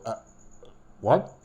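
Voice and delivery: stammered, rising high disbelief; confused shock. Shown as a demonstration. Director showing how a shocked man stammers, high. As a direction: stumble into a high what in shock. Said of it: "You just go".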